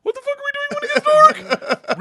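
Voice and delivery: very high, loud panicked question; alarmed squeal. Retold or imitated. Student to gangster about nightfall, high alarmed squeal. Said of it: "I'm like".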